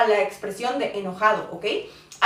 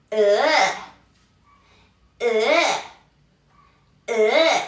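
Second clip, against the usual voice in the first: drawn-out groan of disgust; mock revulsion, repeated as separate groans; disgusted "ugh"; 4 dB louder, much slower than usual. Shown as a demonstration. Teacher showing the disgust expression, disgusted groan. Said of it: "es el tono de asco".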